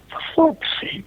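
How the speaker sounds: breathy, high shocked outburst with no voice in it; shocked exclamation. Retold or imitated. Mate Gary hearing his pal was stabbed, breathy shocked exclamation. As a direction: gasp it out in horror.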